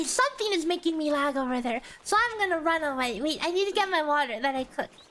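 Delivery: normal talking voice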